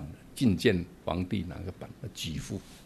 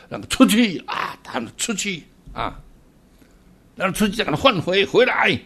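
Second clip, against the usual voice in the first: mock command; 10 semitones higher, 5 dB louder than usual. Master sending disciple out and back.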